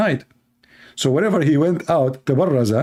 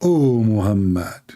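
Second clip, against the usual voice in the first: mock heavenly call; 4 semitones lower, slower than usual. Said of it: "And the voice saying".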